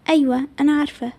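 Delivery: usual speaking voice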